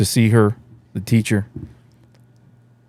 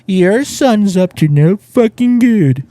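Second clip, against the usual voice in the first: mock teacher voice; 9 semitones higher, much slower than usual. Mocking the teacher's prediction.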